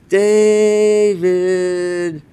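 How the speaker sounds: calling out. Retold or imitated. Wife calling husband who's in trouble, long high call. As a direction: summon him from the other room.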